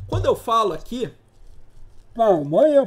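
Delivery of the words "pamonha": said out loud and strong. Shown as a demonstration. Teacher shows the class a loud sound wave, loud "Palmanha". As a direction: belt "Palmanha" out loud to shake the cup. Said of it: "Esse primeiro pamonha foi alto".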